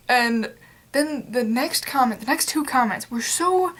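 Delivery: ordinary talk, nothing marked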